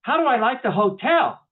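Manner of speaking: loud, high incredulous echo; ugly American indignation. Acted. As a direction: throw the question back, affronted. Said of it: "My inner ugly American is starting to wake up".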